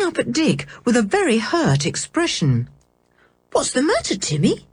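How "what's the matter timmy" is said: put-on a touch of worry in a girl's voice; anxious. George asking her hurt dog, mildly anxious. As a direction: gently ask the dog what hurts. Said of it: "said George anxiously".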